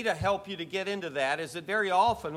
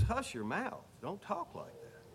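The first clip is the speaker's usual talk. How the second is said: soft rebuke; 10 dB quieter than usual. Acted. Preacher repeating the rebuke to the congregation, hushed and soft. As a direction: say it under your breath, almost private. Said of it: "That's what he's saying".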